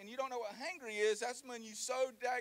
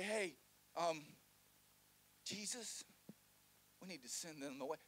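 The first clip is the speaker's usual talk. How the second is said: hesitant disciples; 4 semitones lower, 6 dB quieter than usual. Acted.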